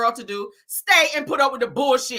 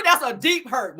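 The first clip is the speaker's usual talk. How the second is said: normal talking voice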